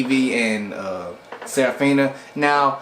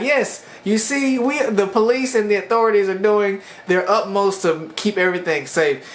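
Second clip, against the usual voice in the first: excited; 7 semitones higher than usual. Retold or imitated. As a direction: gush it out like a proud officer. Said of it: "And she got excited".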